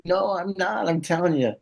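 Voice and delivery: protesting denial. Retold or imitated. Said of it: "I'm like".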